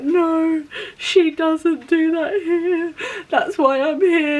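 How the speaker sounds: high, slow, hammed-up sobbing protest; imitated bawling through tears; tearful wail. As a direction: wail it out, stretching every word. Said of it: "I just burst into tears".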